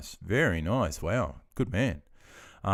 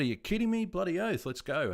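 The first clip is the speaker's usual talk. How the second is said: excited; 10 semitones higher than usual. Retold or imitated. Excited about online game.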